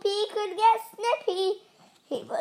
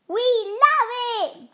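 high, louder happy cheer; 8 semitones higher, 6 dB louder than usual. A narrator's reading. Veggies delighted with the snow, cheered. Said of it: "cheered the wedgies".

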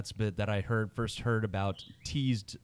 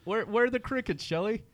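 high-pitched call; 10 semitones higher than usual. Acted. Calling for cricket sound effect.

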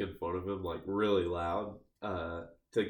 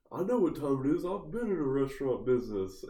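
higher, indignant mock boast; imitated angry retort; 5 semitones higher, 3 dB louder than usual. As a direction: mimic his huffy claim of authority. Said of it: "that made him mad".

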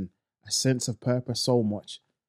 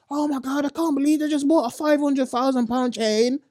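much higher, breathless celebrity-gossip voice; mocking gossip fan; 10 semitones higher than usual. Mocking fans who follow celebrities, in a shrill high gossip voice. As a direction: squeal it like an obsessed gossip blogger. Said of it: "You're sitting down here talking about".

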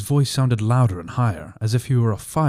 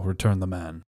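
plain reading voice

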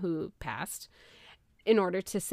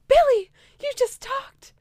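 put-on high, louder amazed old man; lightly voiced astonished grandpa; 9 dB louder than usual. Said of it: "his grandpa was like".

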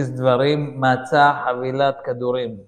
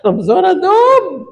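high, loud shout back; exasperated yell; 11 dB louder than usual. Retold or imitated. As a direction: yell back as the harried driver. נהג צועק בחזרה על הנוסעת. (צעקה מיואשת בחזרה)

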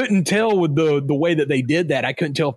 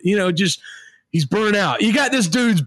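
ordinary talk

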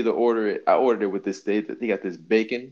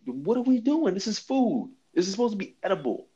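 much higher, fired-up protest; exasperated rant; 10 semitones higher than usual. Real, not acted. He rants high to his friend about burgers that are not edible. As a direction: demand food you can eat, worked up.

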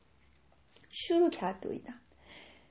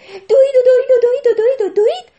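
higher, louder breathless build; building excitement; 11 semitones higher, 15 dB louder than usual. A narrator's reading. Narrator building excitement as the deer races. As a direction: build the run up loudly. Narrating the deer's frantic run.

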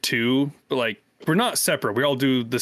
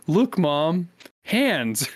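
put-on kid voice; 6 semitones higher, much slower than usual.

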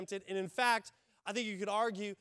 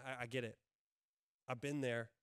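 much softer, lower, tender; gentle Jesus; 7 semitones lower, 7 dB quieter than usual. Acted. Pastor voicing Jesus comforting the tempted, soft and tender. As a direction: reassure them as Jesus would. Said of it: "he's going".

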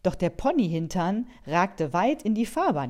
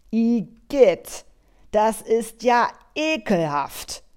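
loud, high, disgusted snarl; a driver recoiling from pony snot, shrieking in disgust; disgusted hiss; 7 semitones higher, 7 dB louder, slower than usual. Acted. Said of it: "fauchte der Cabrio-Fahrer".

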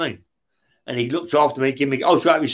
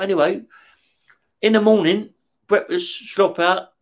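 ordinary talk

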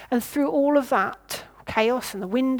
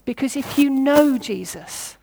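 ordinary delivery

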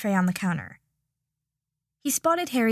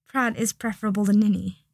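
ordinary delivery, nothing marked

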